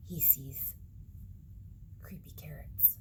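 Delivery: soft, eerie whisper; spooky whisper. A narrator's reading. Reading to kids in a spooky whisper. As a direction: hush it like a secret.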